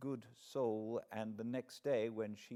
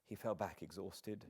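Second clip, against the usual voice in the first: ordinary delivery, nothing marked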